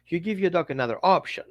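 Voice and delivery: ordinary delivery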